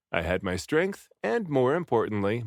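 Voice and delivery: plain reading voice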